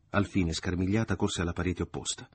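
ordinary delivery

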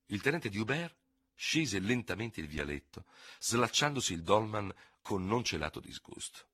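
ordinary delivery, nothing marked

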